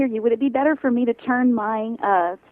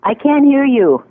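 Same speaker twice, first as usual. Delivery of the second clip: raised, slow, spelling it out; louder, slower, every word clear; 4 semitones higher, 9 dB louder, slower than usual. Real, not acted. Caller repeats over bad line.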